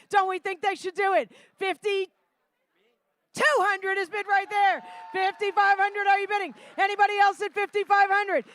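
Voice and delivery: lively auction calling; rally the room for bids; auctioneer. Acted. Live charity auction calling.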